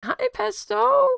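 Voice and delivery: very high, slow sing-song hello; pet greeting voice. Real, not acted.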